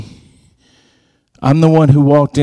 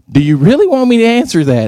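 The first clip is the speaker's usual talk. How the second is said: fast and much higher, mock are-you-sure; teasing warning; 11 semitones higher, faster than usual. Speaker to the nervous new administrator, teasing fast warning. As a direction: tease her before dropping the news.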